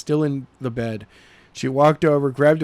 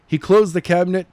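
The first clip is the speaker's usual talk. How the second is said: plain reading voice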